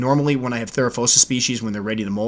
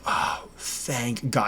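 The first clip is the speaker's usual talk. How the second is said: soft, slow breath of relief; quiet drawn-out thank God; 8 dB quieter, much slower than usual. Retold or imitated. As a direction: let it all out, relieved. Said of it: "And I'm like".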